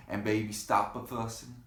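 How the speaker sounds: plain reading voice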